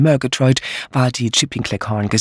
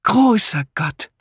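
slow high exclamation; exclaiming; 7 semitones higher, slower than usual. Acted. Bunch exclaiming at breakfast, slow high. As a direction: gasp it out slowly. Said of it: "rief Misses Harmon".

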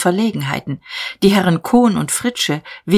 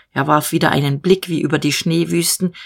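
usual speaking voice

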